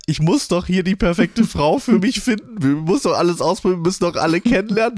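high, fast, eager mock voice of Max; hammed-up high voice of a man on a dating show. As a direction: rattle it off high and breathless like a keen suitor. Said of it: "weil er sagt".

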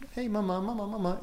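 put-on a toddler tugging for attention; child calling mama. Toddler calling to his mother with the frozen face, pleading child voice.

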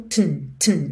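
"tn" with the T held and released into the N; glottal tn. Shown as a demonstration. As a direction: push "tn" inward from T to N. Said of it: "como se fosse um som pra dentro". ("tn" pra dentro, do T direto pro N)

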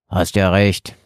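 annoyed. A narrator's reading. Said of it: "antwortete Horace genervt".

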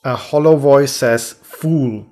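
slow, booming theatrical narrator; hammy stage actor. Acted. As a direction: read it like a ham on stage. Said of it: "Adventures theatralisch aufzuführen".

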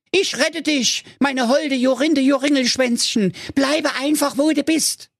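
high, excited hero voice; a silly young hero shouting his idea; hero calling out. Acted. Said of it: "rief er". (hohe, aufgeregte Heldenstimme)

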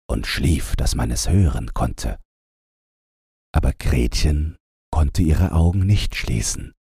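ordinary delivery

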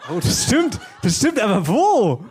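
high, laughing surprise; delighted high exclamation; excited surprise. Real, not acted. Winner found, excited surprise.

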